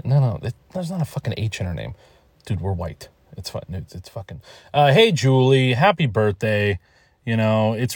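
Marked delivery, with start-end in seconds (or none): none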